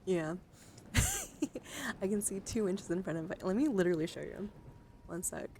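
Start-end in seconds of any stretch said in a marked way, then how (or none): 0.4-2.0 high squealing laugh. Real, not acted. Laughing at own thick glasses.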